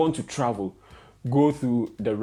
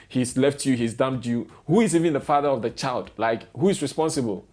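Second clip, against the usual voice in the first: ordinary talk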